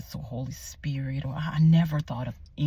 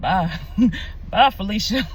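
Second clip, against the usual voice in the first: loud, laughing brush-off; sassy dismissal; 6 semitones higher, 10 dB louder than usual. Retold or imitated. Telling the baby daddy goodbye, loud and sassy. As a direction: wave him away with a loud laugh.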